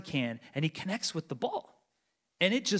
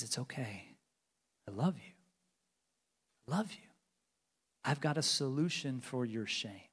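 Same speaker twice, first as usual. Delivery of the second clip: tender reassurance; 4 semitones lower, 5 dB quieter than usual. Acted. Jesus speaks comfort to the ashamed.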